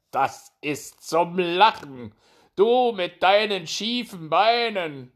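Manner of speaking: high, loud, drawn-out mockery; conceited hare ridiculing a rival; scornful hare. A narrator's reading. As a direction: sneer slowly in a high hare voice.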